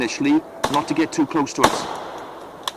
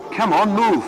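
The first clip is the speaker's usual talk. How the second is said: higher-pitched, pressing command; urgent order; 5 semitones higher than usual. A narrator's reading. Soldiers ordered to stand to, urgent command. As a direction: hurry the men along.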